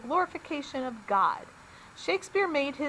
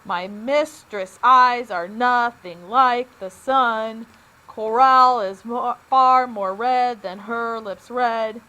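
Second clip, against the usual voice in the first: slow and louder, each second syllable hit: "My mis-TRESS' EYES are NOTH-ing LIKE the SUN"; iambic stress; 7 dB louder, slower than usual. Shown as a demonstration. Teacher reading Shakespeare to students, slow and stressed on the beats. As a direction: beat out the line slowly, leaning hard on every second syllable. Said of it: "Notice I put the stress on the second syllable".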